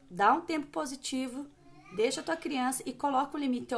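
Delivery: ordinary talk, nothing marked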